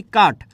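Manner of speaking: কাঠ ending in a hard t, not th; aspiration dropped. Shown as a demonstration. Teacher showing lost aspiration, কাঠ ending in plain t. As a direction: say কাঠ with unaspirated final ট. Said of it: "কাঠরে কি বলছি?".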